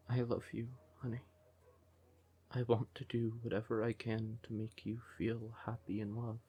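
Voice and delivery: soft, intimate declaration; tender. Acted. Siren declaring love to a doubting partner, tender. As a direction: confide it softly and sincerely.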